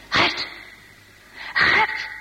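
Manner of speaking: breathy, half-whispered call; urgent hushed call, little voice in it. Acted. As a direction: call a friend's name secretly from outside. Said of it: "Ze roepen aan hem".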